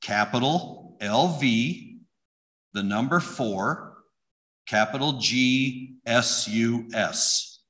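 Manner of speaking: slow, letter by letter; spelling out the plate. Shown as a demonstration. Reading out a friend's license plate, slow and deliberate. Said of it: "his license plate said this".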